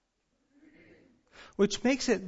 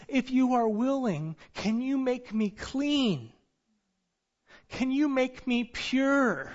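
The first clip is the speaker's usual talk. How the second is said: pleading; 6 semitones higher, 5 dB louder than usual. Retold or imitated. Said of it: "He beseeched him".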